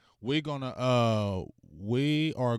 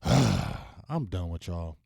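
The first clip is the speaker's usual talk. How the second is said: sighed out very slow and lower; fed-up sigh; 5 semitones lower, much slower than usual. Real, not acted. Done with the support line, slow sighing. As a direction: sigh and give up on them.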